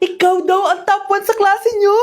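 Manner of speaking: loud, high delighted cheer; mother and child celebrating, loud and high; excited joy. Acted. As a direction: burst out with happy news. News of top of class.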